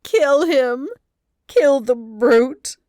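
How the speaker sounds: high, loud, slow anguished cry; moaning. A narrator's reading. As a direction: beg in anguish, dragging it out. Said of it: "moaned Nancy".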